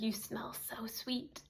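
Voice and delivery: slow, hushed creepy whisper. Retold or imitated. Creep whispering to trapped girl, slow creepy whisper. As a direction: breathe it close and slow like a creep. Creep sniffing her hair.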